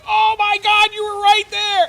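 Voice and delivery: very high, very loud hammed-up surprise; fake surprise. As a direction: overplay the shock of finally spotting him. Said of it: "And I'm like".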